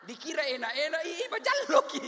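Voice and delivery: high, loud mock whine with a laugh; mock sulking wife. Preacher mimicking a moody wife to the men, high squeal.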